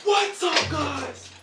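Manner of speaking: high-pitched over-the-top YouTube intro; loud YouTuber voice. Pretend. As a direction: greet the viewers like a hyped-up YouTuber. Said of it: "Loud YouTuber voice".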